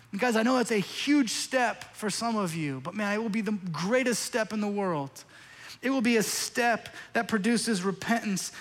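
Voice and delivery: loud, driving sermon voice, pressing each line; preaching at full force. Acted. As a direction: preach it hard to the congregation.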